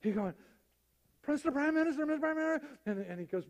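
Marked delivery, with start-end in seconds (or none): 1.3-2.7 high-pitched urgent calling; frantic arrival. Acted. Second official running in to the prime minister, frantic. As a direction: call out in a hurry, pitched way up. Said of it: "another guy running in".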